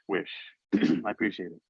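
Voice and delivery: ordinary talk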